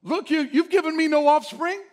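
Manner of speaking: high, loud exasperated complaint; exasperated Abram. Acted. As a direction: plead your case in frustration. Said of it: "He's saying".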